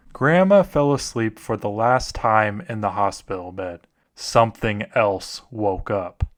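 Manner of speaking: soft ominous read, slowing at the end; story narration. Acted. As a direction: tell it like a grave scary story. Reads a horror story aloud.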